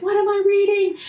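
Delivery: high, loud, alarmed cry of disbelief; freaking out. Retold or imitated. Coworker Doris freaking out over a scary book, shrill alarm. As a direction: squeal it like you're spooked by the book. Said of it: "she was freaking out".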